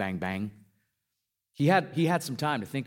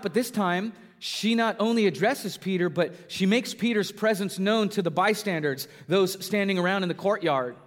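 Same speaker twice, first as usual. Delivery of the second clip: rising, louder preaching build; 8 semitones higher, 4 dB louder than usual. Acted. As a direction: drive the story home from the pulpit.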